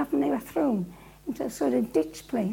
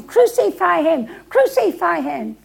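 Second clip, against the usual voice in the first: loud, raised crowd chant; crowd shouting; 3 semitones higher, 9 dB louder than usual. Acted. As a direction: shout it like an angry mob outside. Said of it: "this crowd outside shouting".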